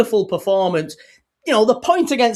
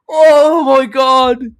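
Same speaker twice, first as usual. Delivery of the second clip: overwhelmed; 3 semitones higher, 7 dB louder, much slower than usual. Real, not acted. After goal.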